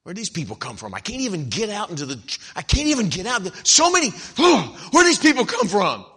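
mock exasperation building to high and loud; complaining about traffic. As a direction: start grumbling, climb into a loud hammed-up rant. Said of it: "you complain about traffic".

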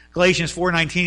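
ordinary delivery

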